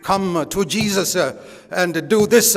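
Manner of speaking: mock preacher emphasis. Shown as a demonstration. Mocking TV preachers' deliberate emphases to the congregation, hammed-up preacher delivery. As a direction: parody a TV evangelist punching every word. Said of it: "use certain emphases when you speak".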